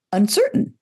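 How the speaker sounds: "uncertain" lifted high, question-like; uncertain upspeak. Shown as a demonstration. Coach showing how upspeak sounds unsure. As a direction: let the word float up unsure. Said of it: "it sounds".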